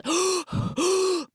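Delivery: high choking gasp; panicked gasp under a pillowcase; sharp gasp for air. Retold or imitated. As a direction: gasp as if smothered. Pillowcase over her head.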